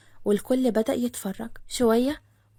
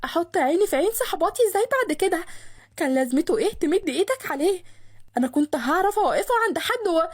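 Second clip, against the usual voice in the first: exasperated yelling; 5 semitones higher than usual. A narrator's reading. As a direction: berate him in a higher, exasperated voice. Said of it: "لسه بتصرخ بغيظ من بروده". (بتزعق بغيظ)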